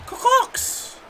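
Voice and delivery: put-on squeaky Boston-accent Car corks; mock Boston accent. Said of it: "He sounds like I'm from Boston".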